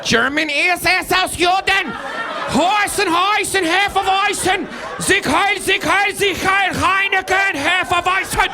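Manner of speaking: shouted German-sounding gibberish; mock German. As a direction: bark like a cartoon German officer.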